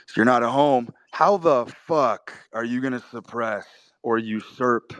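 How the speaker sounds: usual speaking voice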